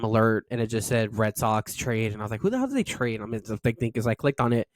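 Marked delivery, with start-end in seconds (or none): 2.4-3.2 much higher, blurted shocked question; shocked disbelief. Retold or imitated. Fan seeing a trade alert, high shocked blurt. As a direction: blurt it out like you can't believe the alert.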